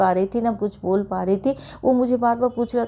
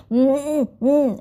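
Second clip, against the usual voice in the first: loud humming through shut lips; closed-mouth hum; 5 semitones higher, 3 dB louder, slower than usual. Retold or imitated. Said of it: "ऐसे करके मैं ज़ोर ज़ोर से". (बंद होंठों से ज़ोर की गुनगुनाहट)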